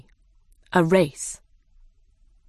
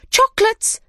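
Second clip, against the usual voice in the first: very high and louder cry; excited cry; 6 dB louder than usual. A narrator's reading. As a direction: squeal it out even louder. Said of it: "they cried".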